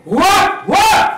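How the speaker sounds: high, loud woofs; tiny mouse barking like a dog. Acted. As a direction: bark fiercely to scare the cat. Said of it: "got up on her tippy toes and said".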